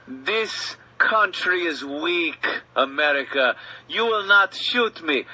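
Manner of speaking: movie villain sneering at the cop he knows can't shoot; foreign bad guy speech. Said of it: "the bad guy starts his speech".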